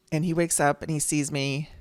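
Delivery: ordinary talk, nothing marked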